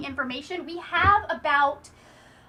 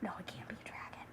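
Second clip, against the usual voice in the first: whispered denial, very soft; 7 semitones lower, 21 dB quieter than usual. Retold or imitated. Heroine refusing to name the dragon, whispered. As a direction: hush it like you can't admit it. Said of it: "And she's just like".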